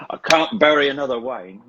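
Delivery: a hint of the aged Caine; older Michael Caine. To a friend, mimicking old Michael Caine from The Trip, lightly. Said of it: "he starts talking like this".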